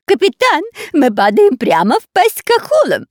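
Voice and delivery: high, loud panicked yell; panicked shout. Acted. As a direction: shout in alarm to the captain. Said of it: "закричал он пирату Бульбулю".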